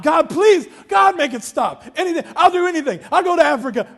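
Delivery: high, loud begging; desperate bargaining prayer. Retold or imitated. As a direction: plead with God at the top of the voice. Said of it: "I'm praying".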